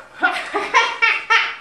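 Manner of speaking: high loud bird cackle; gleeful cackling bird; rook cackle. Acted. Rook cackles to himself in a tree, gleeful.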